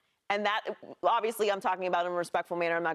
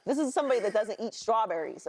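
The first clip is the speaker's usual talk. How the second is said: ordinary talk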